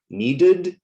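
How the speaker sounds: "Needed" in two clear syllables, high and loud. Shown as a demonstration. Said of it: "two syllables".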